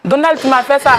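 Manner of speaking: high, loud cry of betrayal; loud reproach. Real, not acted. Betrayed woman confronting Donald, loud high reproach. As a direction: throw the accusation at him at full voice.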